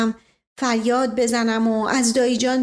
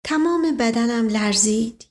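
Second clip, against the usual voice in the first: ordinary delivery, nothing marked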